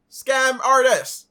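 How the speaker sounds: high, loud, drawn-out call; loud accusation. Real, not acted. Reactor calling out this guy as a fraud, loud accusation. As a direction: shout it slowly as an accusation.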